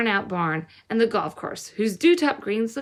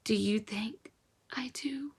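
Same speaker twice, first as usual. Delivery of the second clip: hushed, unvoiced whisper; boy whispering while he weeps; 9 dB quieter, slower than usual. A narrator's reading. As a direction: breathe it through tears. Boy weeping, answering softly.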